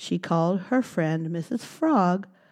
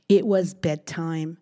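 plain reading voice

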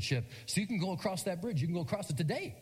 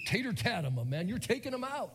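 excited coaches; 7 semitones higher than usual. Retold or imitated. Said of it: "the coaches are calling him Tater".

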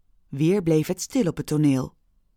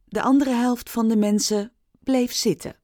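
ordinary delivery

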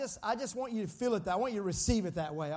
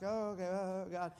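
softer, lower mock-hesitant response; uncertain reaction; 3 semitones lower, 6 dB quieter than usual. Congregation reacting to last week's cliffhanger, mock uncertain. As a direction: imitate listeners left hanging, quietly.

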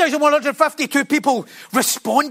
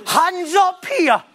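slow, drawn-out preacher call, a little higher; hamming up the altar call once more; mock altar call; 9 semitones higher, much slower than usual. Preacher mocking an altar call to the congregation, drawn out slowly. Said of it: "am I mocking it".

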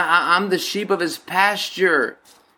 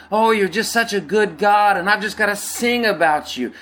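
mild gushing praise; 7 semitones higher than usual. Retold or imitated.

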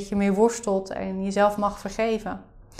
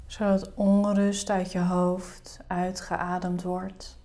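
soft spa whisper; 3 semitones lower, 9 dB quieter than usual. Acted. Leads a guided meditation.

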